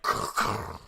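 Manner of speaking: harsh voiceless crackle imitating vines; imitated rasping crack, no voice; cracking vine noise. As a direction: make the sound of vines bursting through. Imitates vines cracking through hedge.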